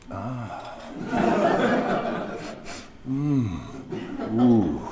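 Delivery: hushed, drawn-out appreciative hums; mock wine-tasting murmurs. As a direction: savour it like a pompous connoisseur. Dom theatrically tasting wine.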